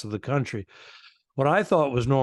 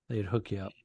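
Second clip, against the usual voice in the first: ordinary talk, nothing marked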